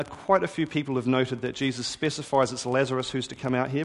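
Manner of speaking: ordinary delivery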